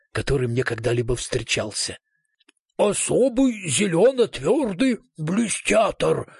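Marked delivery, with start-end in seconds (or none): 2.8-6.3 slow, higher-pitched mumble round a mouthful of rope; mock mouth-full delivery, drawn out; mumbling through a rope. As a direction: talk with a rope held in your mouth. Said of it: "промычал профессор Стега, не выпуская каната изо рта".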